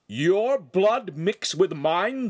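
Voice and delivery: slow, high booming outrage. Acted. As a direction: boom it out slowly. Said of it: "the master boomed".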